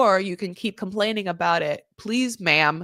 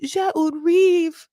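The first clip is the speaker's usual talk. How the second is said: higher, louder drawn-out French cry; imitating the husband; 9 semitones higher, 5 dB louder, much slower than usual. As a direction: declare it grandly in put-on French. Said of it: "He's just like".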